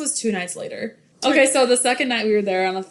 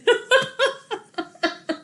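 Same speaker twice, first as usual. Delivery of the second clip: high laugh; 13 semitones higher than usual. Real, not acted.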